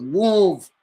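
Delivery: sudden high loud shout of move; shouted order; loud command. Retold or imitated. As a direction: snap the order hard. Acting out crash, ordering.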